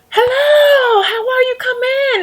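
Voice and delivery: high, loud singsong welcome; sweet host greeting. Retold or imitated. Vlogger mocking a dog owner's cheery welcome, high singsong. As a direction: mimic an overly sweet homeowner at the door. Homeowner greeting at door, mimicked.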